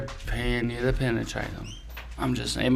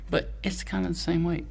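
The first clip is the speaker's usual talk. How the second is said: normal talking voice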